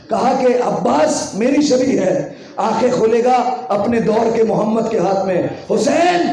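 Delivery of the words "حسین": high ringing call, then down; calling out to Husain. Acted. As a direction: call the son across the room. Ali calls Husain to lift Abbas.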